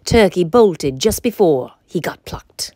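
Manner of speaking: ordinary delivery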